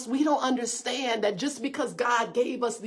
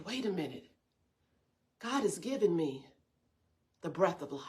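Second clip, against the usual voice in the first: much softer, almost breathed; hushed; 4 semitones lower, 9 dB quieter than usual. Acted. Pastor quoting a prayer of thanks for breath, hushed and soft. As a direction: sink the words down in awe. Quiet awed quote to God.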